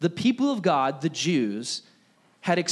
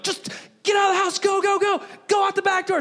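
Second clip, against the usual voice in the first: husband getting his wife out of the house, shouting fast; frantic shout; faster than usual. Retold or imitated. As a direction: rush her out the back door.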